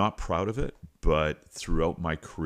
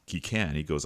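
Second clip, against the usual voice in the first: ordinary talk, nothing marked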